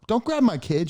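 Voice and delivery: mother ordering him off; protective mom. Retold or imitated. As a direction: mom protecting her son, firm. Mom defends her child.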